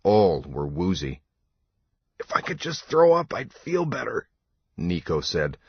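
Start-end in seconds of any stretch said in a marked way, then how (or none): 2.2-4.3 put-on high, queasy young complaint; nauseous misery in a high voice; wretched. A narrator's reading. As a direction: whine it miserably, much higher.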